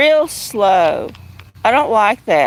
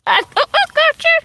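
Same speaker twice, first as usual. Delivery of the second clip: cat-talk voice; faster than usual. Real, not acted. Owner catching the kitten, cooing high. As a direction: coo as she scoops up the kitten.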